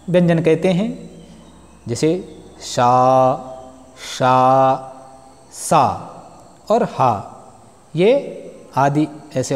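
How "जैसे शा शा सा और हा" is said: शा, सा, हा pushed out with extra breath, slow; aspirated demo. Shown as a demonstration. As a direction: breathe hard on each consonant. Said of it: "बल देना पड़ता है".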